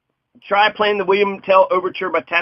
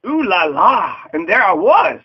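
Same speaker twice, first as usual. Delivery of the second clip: ooh la la; 3 semitones higher, 4 dB louder than usual. Pretend. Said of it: "Begin all your sentences with".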